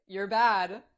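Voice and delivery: loud teasing scold, punching BAD; emphatic playful scolding. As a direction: tease harder, leaning on the last word. Teasing repeated, second take stronger.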